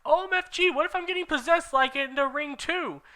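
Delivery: very high frantic questions; Ebony panicking to her friends; panicked. Acted. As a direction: panic about being possessed. Panicking about possession.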